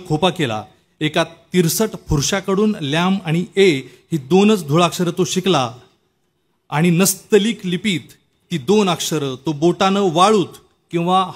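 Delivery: lifted, measured poetry-reading voice; projected recital of a narrative poem; poem recitation. Acted. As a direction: recite it line by line, with deliberate pauses. Recites a favourite poet's poem.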